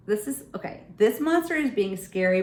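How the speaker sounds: ordinary delivery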